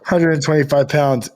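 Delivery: usual speaking voice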